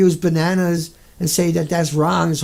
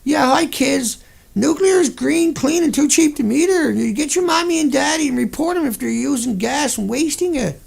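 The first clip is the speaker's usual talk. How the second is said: put-on higher, cheery talking-down-to-kids voice; mock kids' announcer; 6 semitones higher than usual. Mocking a spokesman pitching nuclear to kids, higher sugary cheer. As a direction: sell it like a fake-friendly kids' ad. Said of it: "Get on another radio station".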